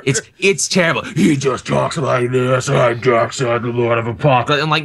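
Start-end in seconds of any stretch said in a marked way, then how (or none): 1.0-4.6 slow, growled mock monster Darkseid; mock monster voice for the worst Darkseid. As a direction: ham it up like a cartoon villain monster. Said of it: "You don't give Darkseid a monster voice".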